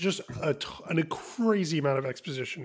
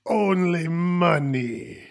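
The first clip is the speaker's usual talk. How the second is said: Watto voice; 2 semitones higher, 7 dB louder, much slower than usual. Retold or imitated. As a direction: draw it out like a greedy alien shopkeeper. Voicing Watto in the retelling.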